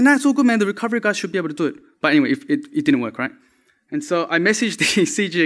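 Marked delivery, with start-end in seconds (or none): 0.0-1.8 higher-pitched, casual brush-off, retold; breezy reassurance. Speaker retelling how they told friends it was fine, breezy. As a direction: wave the worry away, quickly at the end.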